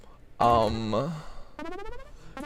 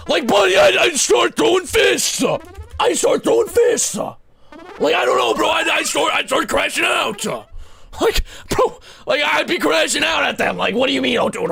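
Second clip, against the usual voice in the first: mock rage; 11 semitones higher than usual. Patient yelling at the doctor, hammed-up fury. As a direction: yell like you're about to swing.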